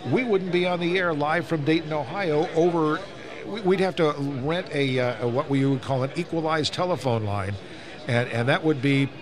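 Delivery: usual speaking voice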